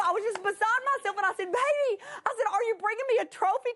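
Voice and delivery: high, fast and giddy; thrilled. Real, not acted. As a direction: bubble over with excitement. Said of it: "I was just so thrilled".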